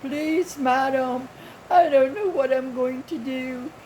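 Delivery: imitating a scammer's high, whiny crying plea; mock tearful begging, high-pitched. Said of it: "he's crying".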